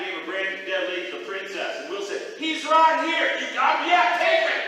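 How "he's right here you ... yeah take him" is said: loud, pushy brothers handing him over. Acted. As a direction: call it out loud and higher. Brothers hand Jack over, loud.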